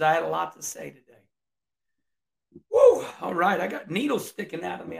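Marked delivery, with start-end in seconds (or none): 2.7-3.1 a loud, high whoop of pure excitement; jacked-up woo. Real, not acted. Host whoops to his viewers, all jacked up. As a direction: let out a wild, pumped-up holler. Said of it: "I was so jacked up right there".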